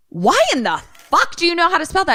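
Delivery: high, stunned disbelief; incredulous. Retold or imitated. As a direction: ask it in total shock. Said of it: "And I was like".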